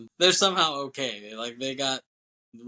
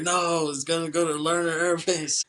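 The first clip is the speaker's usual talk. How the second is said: higher-pitched mock protest; exasperated insistence; 6 semitones higher, 4 dB louder than usual. Larkin insisting to Malloy who won't listen, exasperated. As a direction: act out pleading to be believed.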